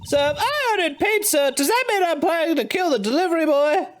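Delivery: high mocking character voice; high sarcastic voice. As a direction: sneer it up high. Voicing a character's sarcastic line.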